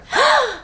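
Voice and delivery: high sharp mock gasp; startled gasp. Bella startled.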